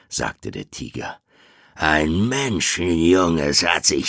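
loud, demanding tiger. Acted. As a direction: shout the tiger's claim. Tiger demands the man-cub. (lauter, fordernder Tiger)

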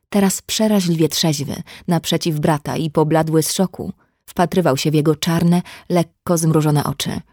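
plain reading voice

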